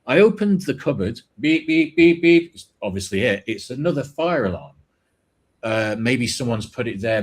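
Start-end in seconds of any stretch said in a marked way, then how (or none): 1.4-1.9 high, louder mock beeps; imitated beeping. Mimicking alarm as cupboard opens. 1.9-2.5 higher and much louder mock beeps; louder imitated beeping. Mimicking alarm, growing louder.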